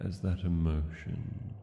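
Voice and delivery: usual speaking voice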